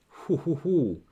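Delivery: usual speaking voice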